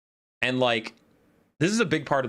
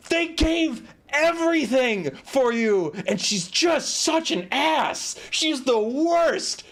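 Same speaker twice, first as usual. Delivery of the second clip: high, drawn-out indignation; slow down and hammer each complaint, pitched high; exasperated rant; 11 semitones higher, slower than usual. Real, not acted. Streamer fumes about an ungrateful book character, exasperated and slow.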